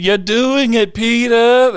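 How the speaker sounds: high, loud Lois voice; Lois impression. His wife cheering him on in a high Lois voice. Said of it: "[name] kills Lois".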